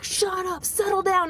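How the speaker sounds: high, tense imitation of an angry cop; impatient command, pitched well up; cop's angry order. Cop ordering screaming woman to shut up, high and angry. Acts out cop silencing her.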